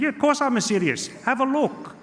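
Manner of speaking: put-on high, insistent Italian-accented uncle; Gino. Uncle insisting to doubtful nephew, high Italian accent. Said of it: "He said".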